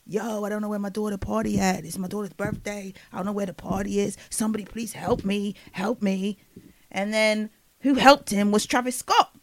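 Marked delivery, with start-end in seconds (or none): 0.1-6.4 soft, droning mock plea; a put-on rapper voice, pleading quietly. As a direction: beg softly in a mock husky monotone. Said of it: "and be like".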